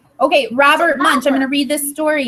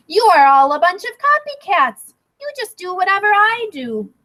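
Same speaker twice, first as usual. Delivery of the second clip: a touch of scorn, higher; scornful girl; 7 semitones higher than usual. Acted. Stephanie mildly scolding the copycat girls. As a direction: scold them lightly. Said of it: "Stephanie looked at them and said".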